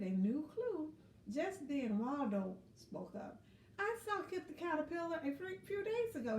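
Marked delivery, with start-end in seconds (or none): 3.8-6.4 Waldo's voice. Acted. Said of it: "he said".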